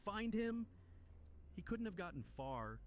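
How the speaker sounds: high, loud shouted order, easing slightly; exasperated leader yelling to the party; frustrated yell. A narrator's reading. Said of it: "yells in frustration".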